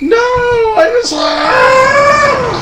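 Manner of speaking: high, slow panicked yelp; panicked NPC cry. Acted. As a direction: cry out in sudden alarm. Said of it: "He's like".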